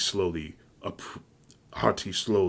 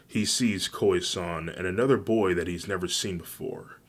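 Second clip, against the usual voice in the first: ordinary delivery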